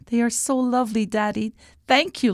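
put-on high, sweet child's voice; high-pitched touched child; grateful little princess. A narrator's reading. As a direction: thank Daddy like a little girl. Princess thanks her father.

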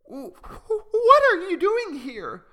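high, small and trembling, slow; a frightened little bird on the verge of tears; tearful little voice. Acted. Malu surprised by Okazu while crying, high and tearful. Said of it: "she says, sobbing".

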